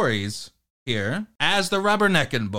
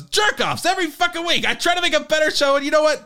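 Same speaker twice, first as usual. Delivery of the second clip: higher, shouted mock rant; imitating angry rant; 6 semitones higher than usual. Host recalling the rant, mock shouting it again. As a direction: rehash the rant in his voice. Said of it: "he goes".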